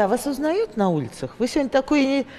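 ordinary talk, nothing marked

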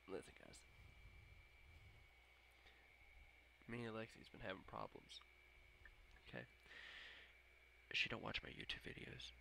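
hushed, joking confession; mock confiding. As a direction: confide quietly in the viewers.

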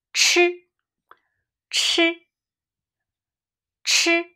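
吃 held high and flat; high level tone 吃. Shown as a demonstration. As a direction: keep 吃 level at the top of the voice. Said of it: "tone demo".

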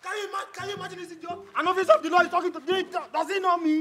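a pompous officer shouting in outrage; outraged officer. Acted. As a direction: rage at the insolent boy. Officer rages at insult.

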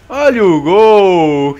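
loud, slow drawn-out goal call. Real, not acted. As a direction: stretch it out like a commentator. Calling out the goal.

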